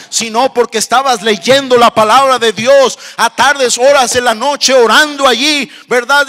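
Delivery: fervent pulpit crescendo; impassioned preaching. Real, not acted. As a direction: build from firm exhortation to full-force preaching. Preaching, building to full force.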